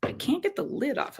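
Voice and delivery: strained, breathy push on "I can't get the lid off of it"; straining to twist a stuck lid; opening a stuck jar. Real, not acted. Straining to open mango jar.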